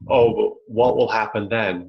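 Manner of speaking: usual speaking voice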